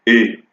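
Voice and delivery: E said as an open vowel. Shown as a demonstration. Teacher showing how grave-accent E sounds, open E. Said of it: "ouverte, c'est-à-dire".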